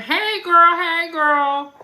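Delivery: high, loud happy hello; loud greeting. Real, not acted. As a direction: call out to a friend arriving. Greeting someone who walks in.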